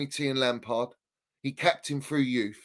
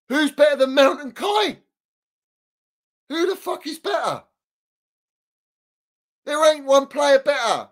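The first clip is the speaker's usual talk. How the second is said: high, loud furious outburst; angry shout; 10 semitones higher, 5 dB louder than usual. Real, not acted. Fan ranting to the host, shouting high and loud. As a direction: yell it out in disbelief.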